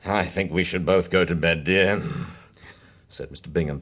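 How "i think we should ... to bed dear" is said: out of breath. Acted. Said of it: "panting heavily".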